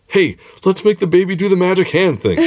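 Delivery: high, laughing, wheedling coax; playful high coaxing at the baby; eager Greef Karga. Retold or imitated. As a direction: coax the baby like a giddy fan. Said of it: "He is all of us watching this episode when he says".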